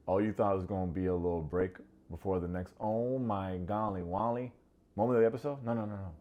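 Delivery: normal talking voice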